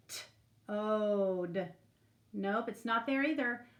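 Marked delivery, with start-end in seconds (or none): 0.1-1.7 T-ode split into slow separate sounds; segmented word. Shown as a demonstration. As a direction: break T-ode apart sound by sound. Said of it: "How about this room?".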